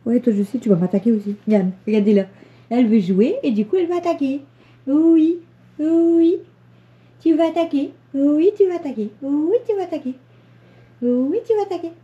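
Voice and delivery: playful pet talk. Real, not acted. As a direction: egg the kitten on playfully. Teasing playful cat.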